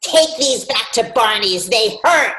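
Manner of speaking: nasty boss. Retold or imitated. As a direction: hurl the order at an intern, mimicking a horrible boss. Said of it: "Horrible, nasty".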